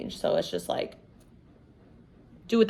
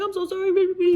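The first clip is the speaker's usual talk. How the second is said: husband's apology; 9 semitones higher, faster than usual. Retold or imitated. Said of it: "he's like".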